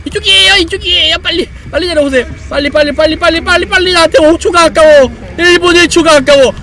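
loud urgent calling. Real, not acted. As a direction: call them over urgently. Calling a character to come.

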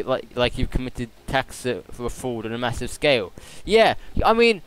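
usual speaking voice

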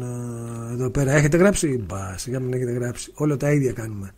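quiet, low-pitched aside while checking; soft, low off-hand remark to self; low self-talk. Real, not acted. Checking for questions, talking to himself.

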